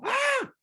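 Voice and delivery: very high, sudden cry of surprise; big cry. Retold or imitated. Woman on the phone suddenly stands, very high cry. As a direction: yelp it out in shock. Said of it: "elle entend un grand".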